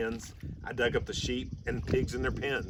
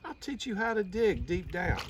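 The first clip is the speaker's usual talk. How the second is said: put-on eager boy; 10 semitones higher than usual. A narrator's reading. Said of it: "cried Sammy Brown".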